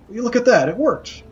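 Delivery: high delighted squeal; excited. Acted. Skull delighted its body works.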